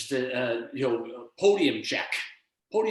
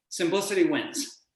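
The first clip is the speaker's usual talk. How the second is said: normal talking voice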